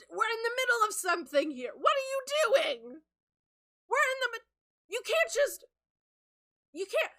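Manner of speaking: high, loud indignant scolding; fed-up cartoon henchman telling them off; exasperated seagull. Seagull scolds couple for interrupting.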